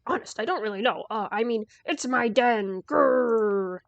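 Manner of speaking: loud growling wolf voice; big bad wolf. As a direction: boom it out like a fearsome beast. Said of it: "you're not really a wolf".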